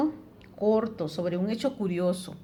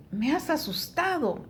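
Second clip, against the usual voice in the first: high, expressive startled exclamation; 7 semitones higher than usual. Shown as a demonstration. Teacher shows students how to read an expression aloud, exclaiming. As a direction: read it out as a demonstrated cry of fright, pitched up. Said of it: "una expresión".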